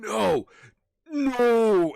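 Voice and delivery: a giant screaming No in rage; furious scream. Acted. Kraken screaming at the freed patron, enraged roar. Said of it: "just scream".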